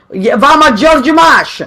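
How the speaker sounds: a hint of an old Italian grandmother's accent; lightly voiced grandma with her accent. Grandma naming the store, lightly accented old-lady voice. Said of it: "she used to call it".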